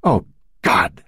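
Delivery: choked cry of despair; understated anguish; voice breaking. A narrator's reading. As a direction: let the voice crack on the cry. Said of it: "her own voice breaking now".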